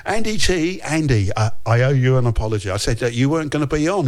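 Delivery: ordinary talk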